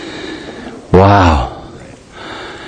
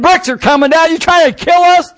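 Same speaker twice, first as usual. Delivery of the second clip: higher, accusing cry; indignant prisoner; 9 semitones higher than usual. Acted. Prisoner crying out as bricks fall, indignant. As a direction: demand it out of panic.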